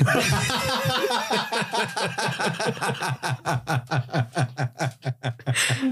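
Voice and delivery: long, high laughing fit; helpless laughter. Real, not acted. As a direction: crack up and keep going. Helpless laughing fit at own joke.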